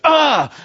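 a high, loud angry shout; sudden high exasperated yell; frustrated yell. Shown as a demonstration. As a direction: let it burst out at the printer. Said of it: "I just have this outburst".

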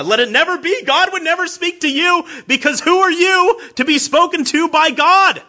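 preacher at full force against disbelief in a God who speaks; fiery preaching. Acted. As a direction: thunder it from the pulpit.

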